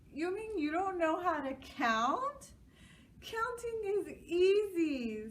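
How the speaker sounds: put-on high taunting child voice; mocking kid. A narrator's reading. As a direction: tease him like a snotty kid. Girls mock.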